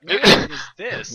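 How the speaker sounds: sudden puzzled high exclaim; surprised. Retold or imitated. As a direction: caught off guard by a strange song. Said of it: "I'm like".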